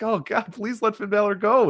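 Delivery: mock weary plea. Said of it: "It's like".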